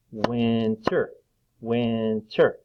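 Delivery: "winter" in two drawn-out beats; split into syllables. Shown as a demonstration. Teacher clapping syllables for children, "winter" split in two. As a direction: stretch and separate both parts of "winter". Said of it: "clap out the different parts".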